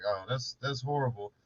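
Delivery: low, soft, unbothered sympathy; mock agent sympathy. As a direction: imitate an agent coolly humouring a caller. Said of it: "He'd be like".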